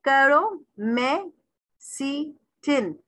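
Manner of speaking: करोमेसीटिन very slow, each syllable separate; teacher chopping करोमेसीटिन into stretched beats. Shown as a demonstration. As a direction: pause after every syllable of करोमेसीटिन. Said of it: "five syllables हैं".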